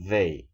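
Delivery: "They" with a firm, heavy D onset, said loud; deep-rooted D. Shown as a demonstration. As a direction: punch the D sound opening "They". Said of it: "con una entonación mucho más arraigada". ("They" con una D más arraigada y fuerte)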